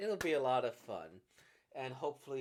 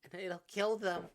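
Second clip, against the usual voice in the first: tense voice rising to a loud, high cry; building to a cry; 5 semitones higher, 4 dB louder than usual. Acted. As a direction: escalate into a high, loud outburst. Acted curse scene, rising to shout.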